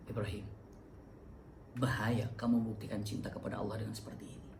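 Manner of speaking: hushed, sly devil's whisper; tempting whisper. Acted. Satan whispering doubt into Ibrahim's ear, hushed and sly.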